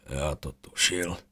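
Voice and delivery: soft, slow pained mumble; muttered. A narrator's reading. Wounded Déric mutters to himself in the rubble, soft mumble. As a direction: mutter it under your breath. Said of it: "zamumlal Déric".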